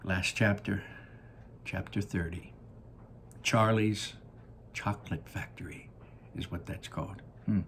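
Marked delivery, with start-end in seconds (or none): none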